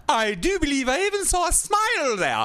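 very high, sing-song Disney godmother; hammed-up twittering fairy godmother. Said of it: "she talks like a fairy godmother from a Disney movie".

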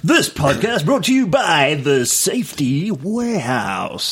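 hammed-up ad-read voice; playful fake sponsorship read; mock sponsor announcer. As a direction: announce it like a podcast sponsor plug.

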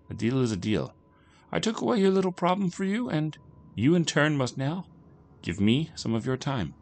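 strange bargain-maker, composed. A narrator's reading. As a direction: lightly play a sly, unruffled dealmaker.

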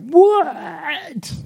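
high, squeaked-up what of disbelief; a sudden high-pitched retold gasp of surprise; shocked what.

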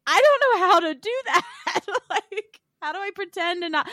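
high-pitched comic wail through laughter; mock-distressed protest. Daughter protesting mom's advice to back off, mock-distressed protest. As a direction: plead helplessly, half laughing.

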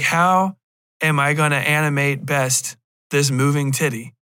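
usual speaking voice

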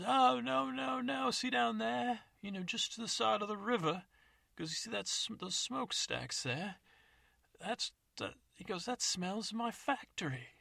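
put-on a greedy old codger eager for the carcass; old man.

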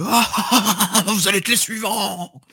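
manic cackling taunt, high and loud; unhinged villain. Acted. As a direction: shout it like a crazed villain, laughing. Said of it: "je crie, je fais".

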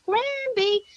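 high, sing-out two-note holler; yelled call. Retold or imitated. Grandmother showing child what to yell, high drawn-out call. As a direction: stretch the name out as a high call. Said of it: "I want you to yell back".

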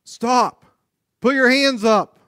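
mock police shout; police command. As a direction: play the cop yelling at a runner. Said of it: "what are they gonna say".

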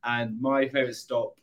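usual speaking voice

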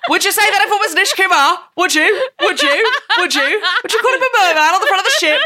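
high, loud indignant challenge; mock outrage. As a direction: play it hammed-up outraged.

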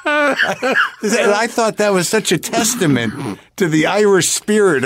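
talking through a fit of laughter; laughing through words. Real, not acted. Guest laughing at her own Irish joke, laughing.

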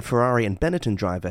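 ordinary delivery

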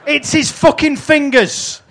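slow, high outraged cry; exasperated disbelief. Acted. Straight-man wrestler pointing out the fake gun, exasperated disbelief. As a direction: drag out the comic exasperation. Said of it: "Thatcher is going".

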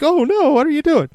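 imagined panicked wife catching him; mock wife shriek. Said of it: "then be like".

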